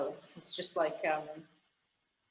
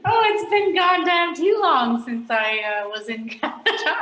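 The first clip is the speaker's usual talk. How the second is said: high, loud cry of self-exasperation; real exasperated outburst; 8 semitones higher, 7 dB louder than usual. Real, not acted. Expat forgetting Canada's provinces, loud exasperated outburst. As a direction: burst out high and loud after laughing. Laughing dismay at forgotten provinces.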